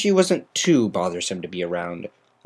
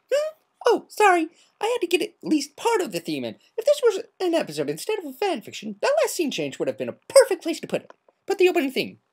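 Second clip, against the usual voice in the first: much higher, quick chirpy party pony; bubbly Pinkie Pie; faster than usual. Acted. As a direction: rattle it off, giddy and playful.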